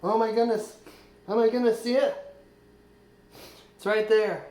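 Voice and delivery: breathless real thrill at seeing it; real excitement. Real, not acted. Removing metal from own leg.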